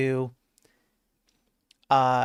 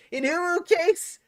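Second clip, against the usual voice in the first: much higher, slow, cutesy squeak on uwu; mock a cutesy uwu voice; squeaky uwu voice; slower than usual. Streamer mocking a chat request, squeaky uwu voice. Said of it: "Don't speak in Uwu case".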